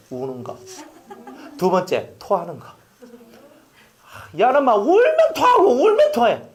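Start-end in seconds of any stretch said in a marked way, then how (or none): 4.4-6.4 high, loud laughing delivery; 웃으며 크게. Real, not acted. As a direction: laugh through the telling. Laughing about crying baby.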